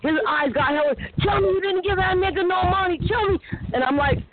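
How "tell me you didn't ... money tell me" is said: pitched-up furious demand, imitated; high, outraged retold demand; angry demand. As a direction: play the jealous boyfriend snapping at her. Boyfriend confronts her about loan.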